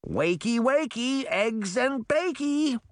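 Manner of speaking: very high, teasing sing-song; mock-sweet wake-up call. A narrator's reading. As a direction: chirp it in a drawn-out, high lilt. Puck rousing a sleeping man.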